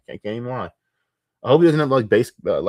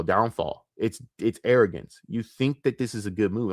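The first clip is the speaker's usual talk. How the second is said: ordinary talk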